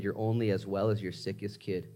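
ordinary talk, nothing marked

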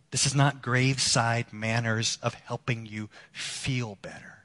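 usual speaking voice

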